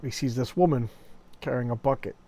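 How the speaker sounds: ordinary talk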